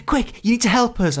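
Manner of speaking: high, urgent, breathless plea; urgent role-play actor. Retold or imitated. Role-play actor begging the hotel guest for help, high and urgent. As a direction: rush the guest into the mission. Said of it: "people running and going".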